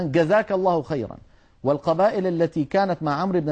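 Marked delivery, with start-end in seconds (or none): 0.0-1.2 "جزاك" said "gazaak"; Yemeni g for jeem. Retold or imitated. Teacher quoting southern Arabian speakers, g for jeem. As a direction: open "جزاك" on a hard g. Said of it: "يقولون مثلًا".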